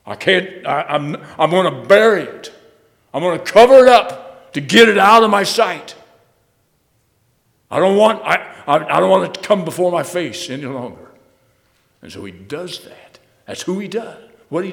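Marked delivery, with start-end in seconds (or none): 0.1-11.1 louder, higher voice of an offended God; voicing God. Preacher voicing God refusing to see the ugliness, raised and pained. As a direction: speak as God who can't bear the sight. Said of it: "saying this".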